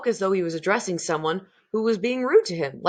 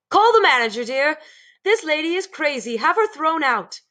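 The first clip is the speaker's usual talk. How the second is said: shrill snooty woman; 9 semitones higher, 4 dB louder than usual. Acted. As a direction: sneer like a posh society wife. Mrs Jenkins shrill.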